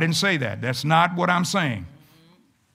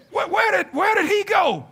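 high shouted where did he go; mock bewilderment; 12 semitones higher than usual. Preacher acting out a lender's confusion, high shout. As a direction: call out for the vanished debtor, pitched way up. Owner asks where the debtor went.

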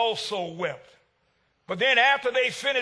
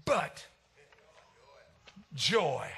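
tender comfort; 7 semitones lower, 8 dB quieter than usual. Real, not acted. Preacher gently quoting that joy comes in the morning. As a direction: comfort them one word at a time.

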